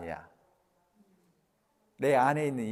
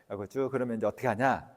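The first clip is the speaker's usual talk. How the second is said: normal talking voice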